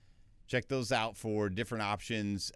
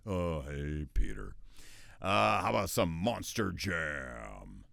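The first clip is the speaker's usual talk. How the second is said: lower, slow put-on cartoon voice; cartoon character voice; 4 semitones lower, 3 dB louder, slower than usual. Shown as a demonstration. Voice actor switching to another cartoon voice. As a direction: drawl it lazily.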